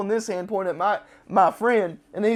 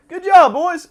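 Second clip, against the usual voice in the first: higher, loud cheer of praise; hearty praise; 10 semitones higher, 10 dB louder, slower than usual. Retold or imitated. Overseer praising the team before walking out, loud. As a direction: cheer them on like a drill sergeant. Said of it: "he's like".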